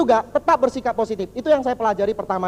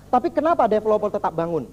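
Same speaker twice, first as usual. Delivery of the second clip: ordinary talk, nothing marked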